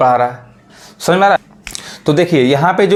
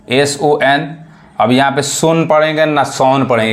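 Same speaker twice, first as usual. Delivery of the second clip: ordinary talk, nothing marked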